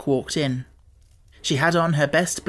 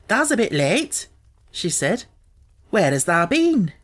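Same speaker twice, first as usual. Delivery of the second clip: a lightly higher Yorkshire maid, slow and friendly; Martha's Yorkshire voice; 4 semitones higher, slower than usual. A narrator's reading. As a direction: voice the country maid gently.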